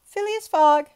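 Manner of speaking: high, very loud summons; demanding call. A narrator's reading. Judge summoning the accused, a loud demand. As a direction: call the name across the court. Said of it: "demanded Oyster Puff".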